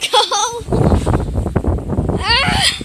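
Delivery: panicked shout. Real, not acted. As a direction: shout as you flee. Running from gust.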